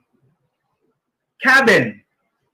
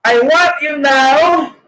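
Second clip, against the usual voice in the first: ordinary talk, nothing marked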